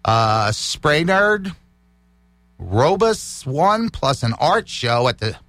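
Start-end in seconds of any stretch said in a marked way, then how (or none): none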